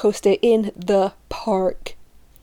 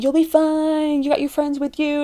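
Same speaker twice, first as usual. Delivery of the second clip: mock-soothing singsong, raised; breezy reassurance; 6 semitones higher than usual. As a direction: pitch it up to brush off a friend's fears. Friends reassuring her before the ride.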